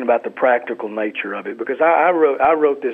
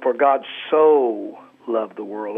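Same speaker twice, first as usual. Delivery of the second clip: For God so loved the world, with so stressed; 2 semitones lower, slower than usual. Shown as a demonstration. Pastor shows stressing a different word, now so. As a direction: hit the word so. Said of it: "inflect a different word every time".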